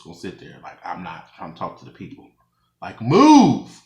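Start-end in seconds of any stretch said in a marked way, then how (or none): 2.8-3.8 loud fed-up yell; annoyed shout. Retold or imitated. Yelling at hair in her face.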